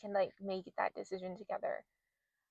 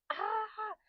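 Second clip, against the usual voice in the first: mock pained yell; 13 semitones higher than usual. Telling how she squeezed the nurse's hand, mock pained yell. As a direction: act out a pained "ah" at held-back volume. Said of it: "was yelling and, like, squeezing".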